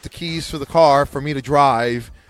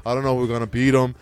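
lower, fretful coach downplaying his team; mock Lou Holtz; 6 semitones lower than usual. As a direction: sandbag like a worried old coach. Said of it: "You know how Lou Holtz used to go".

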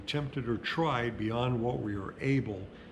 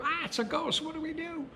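high, panicky cry of frightened disciples; terrified fishermen crying out at a ghost; panicked disciples. Acted. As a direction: squeal it like men who saw a ghost.

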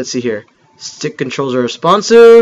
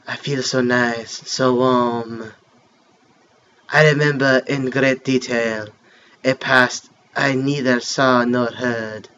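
softer, lower storytelling read; story narration; 4 semitones lower, 4 dB quieter than usual. Acted. Gamer reading the game's opening story, soft narration. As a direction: read the legend like a mock French narrator. Reads game intro narration aloud.

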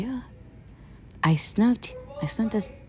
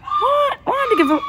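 much higher, louder alarmed yelp; stunned scientist crying out in alarm; shocked cry; 6 dB louder, faster than usual. Acted. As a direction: gasp it out in shock.

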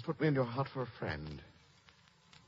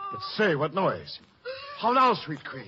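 high, louder startled call; alarmed; 9 semitones higher, 6 dB louder than usual. Acted. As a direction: call out at the sudden noise. King startled by a noise.

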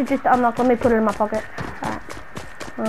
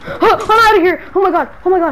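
panicked escape; 5 semitones higher, 4 dB louder than usual. Acted. Escaping from the crazy girl, gasping panic. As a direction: gasp and flee in a panic.

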